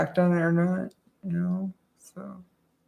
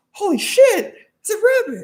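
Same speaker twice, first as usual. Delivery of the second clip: much higher fake astonishment; mock wonder; 4 dB louder, faster than usual. Magician pretends to be amazed by his own trick, hammed-up. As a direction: gasp over the rabbit like a fool. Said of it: "And it's like".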